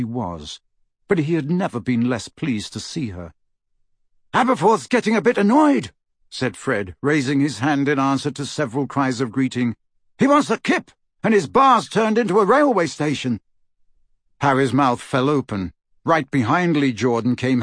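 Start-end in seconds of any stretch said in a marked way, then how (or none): 4.3-5.9 high, loud character voice; loud, high-pitched quip; breezy Fred. Acted. Voices Fred announcing himself. 10.2-13.4 high, loud character voice; loud, high-pitched quip; breezy Fred. Acted.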